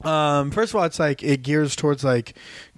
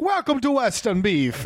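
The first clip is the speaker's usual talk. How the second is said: put-on Jamaican accent; 6 semitones higher than usual. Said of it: "I'm losing the accent".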